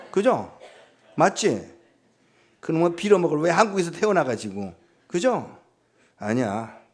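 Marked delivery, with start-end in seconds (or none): none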